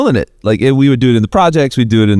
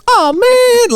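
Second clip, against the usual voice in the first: broke character. Retold or imitated. Tough rapper breaks character at the hat rule, high and meek. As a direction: drop the tough act and sound meek. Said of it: "as though he just broke character".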